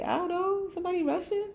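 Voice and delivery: very high, quizzical put-on voice; playful puzzled squeak. Host guessing who speaks like that, playful high puzzlement.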